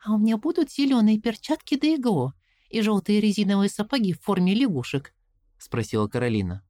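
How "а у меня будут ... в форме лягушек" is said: put-on worried young girl, higher; anxious little girl asking; girl's voice. A narrator's reading. Caroline asks. (голос встревоженной девочки)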